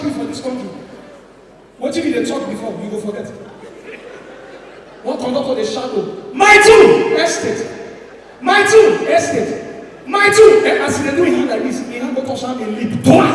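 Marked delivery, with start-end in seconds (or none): 6.3-10.6 slow, drawn-out pleading chant, loud and high; mock beggar cry. Comedian mimics a beggar's loud drawn-out cry. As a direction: stretch each word like a street beggar.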